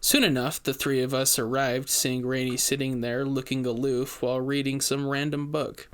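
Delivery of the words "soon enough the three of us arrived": ordinary delivery, nothing marked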